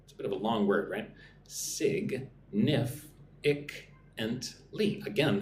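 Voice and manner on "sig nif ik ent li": sig, nif, ik, ent, li broken into separate beats; slow syllable-by-syllable drill. Shown as a demonstration. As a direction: split significantly into its five syllables. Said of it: "five syllables".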